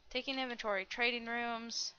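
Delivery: ordinary talk, nothing marked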